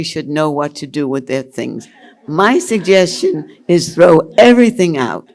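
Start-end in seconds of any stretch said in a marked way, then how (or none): 2.3-5.3 emphatic. Real, not acted. Emphatic advice.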